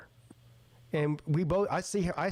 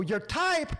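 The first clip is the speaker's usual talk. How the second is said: high-pitched echo in disbelief; incredulous; 9 semitones higher than usual. Retold or imitated. Friend answering "not really my type", incredulous. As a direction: throw the words back, pitched up and scandalised.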